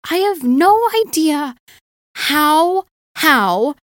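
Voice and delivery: high, slow, incredulous; amazed disbelief. Retold or imitated. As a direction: marvel at it slowly. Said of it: "And I was like".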